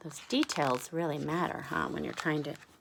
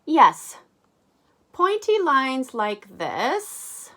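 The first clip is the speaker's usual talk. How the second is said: louder, higher, slow and drawn out; character voice; 7 semitones higher, 10 dB louder, much slower than usual. Acted. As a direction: savour each word, much higher. Character voice while painting.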